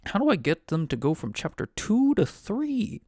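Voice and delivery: high-pitched, baffled plea; mock exasperation. Game master puzzling over the book's chapters, mock exasperated.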